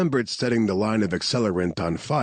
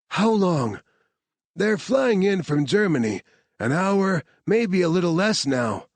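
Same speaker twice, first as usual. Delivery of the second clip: higher voices, pressing; urgent exchange; 8 semitones higher than usual. Acted. Scientist pressing the team for biohazard suits, higher and urgent. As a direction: push for the suits now. Claire and Harvard argue.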